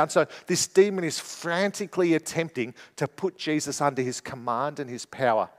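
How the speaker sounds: normal talking voice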